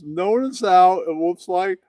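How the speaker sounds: usual speaking voice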